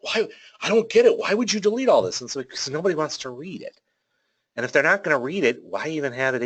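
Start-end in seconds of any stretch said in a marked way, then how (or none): 0.0-2.2 higher and louder, mock disbelief; hammed-up shocked client protesting. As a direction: play the baffled client who can't believe it.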